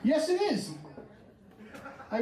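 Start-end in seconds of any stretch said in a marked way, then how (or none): 0.0-0.7 high-pitched put-on phone voice; mock phone voice, pitched up; disguised voice. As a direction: lift the voice well above your own. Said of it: "I disguise my voice".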